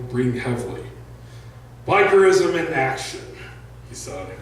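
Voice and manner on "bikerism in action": excited. Acted. Breathless Mick proud after the march.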